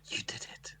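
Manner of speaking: hushed, intimate whisper. Acted. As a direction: whisper it close and soft. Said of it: "You whisper it".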